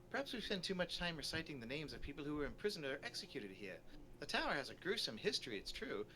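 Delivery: lecturing storybook guide; lilting, formal lecture voice; tour guide character. Acted. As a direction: play a learned guide addressing a group. Voices Doctor Pells lecturing.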